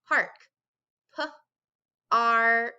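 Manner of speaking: "Park" then "P-R-K" sounded out letter by letter; stretched sounds. Shown as a demonstration. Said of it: "One more time".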